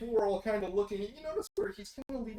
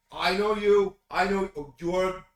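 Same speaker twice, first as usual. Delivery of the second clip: loud, chummy recognition; gladhanding pundit; 11 dB louder than usual. Acted. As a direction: boom out a fake-friendly greeting. Pundit greets Victor loudly.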